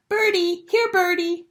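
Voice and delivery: put-on high, little-kid call to pigeons; toddler calling. A narrator's reading. Fudge chasing pigeons, calling high like a toddler. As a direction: coax the birds like a small child. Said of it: "he called".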